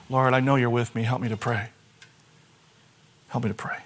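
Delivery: soft, low, hushed prayer. Shown as a demonstration. Preacher demonstrating a quiet prayer to the church. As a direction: murmur it like a private prayer.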